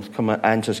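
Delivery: ordinary delivery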